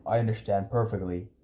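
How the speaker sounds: low, controlled firmness; low, unbending uncle voice; firm refusal. Acted. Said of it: "he said firmly".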